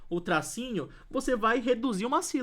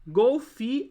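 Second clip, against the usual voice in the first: "golfi" said loud and higher, left unfinished; word cut before last syllable; 5 semitones higher, 7 dB louder than usual. Shown as a demonstration. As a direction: stop after "golfi", holding back the ending. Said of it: "aí não dá pra colocar um nho".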